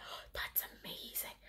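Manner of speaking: soft unvoiced whisper of wonder; awed whisper. Recalling seeing the garden pattern, awed whisper. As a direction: breathe it out in quiet amazement. Quoting her reaction to pattern.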